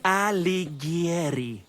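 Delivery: Alighieri said slowly, each syllable Italian: a-li-GYE-ri; correcting pronunciation. Real, not acted. Poet corrects his friend's botched Alighieri, slow and exact. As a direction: pronounce Alighieri slowly and properly, Italian style. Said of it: "you dolt".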